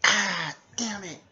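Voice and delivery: loud, high yelp and curse; mock cry of pain. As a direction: yelp as if just struck. Character is hit in the hand.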